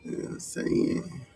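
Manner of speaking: muttering low to himself while fixing the mic; low, half-heard mumble as the setup fails; quiet. Real, not acted. Vlogger fixing his mic, mumbling under his breath.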